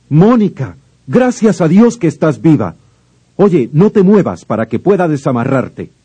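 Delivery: loud, high relief; relieved shout. Acted. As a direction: call out in relief. Encuentra a Mónica atada. (grito de alivio)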